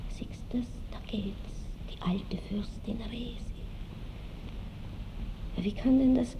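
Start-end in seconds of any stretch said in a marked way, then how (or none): 0.1-3.4 hushed stage whisper. Acted. Marschallin imagining people pointing at the old princess, whispered. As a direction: whisper it like onlookers gossiping behind her back.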